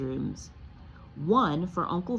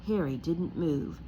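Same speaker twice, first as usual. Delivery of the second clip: plain reading voice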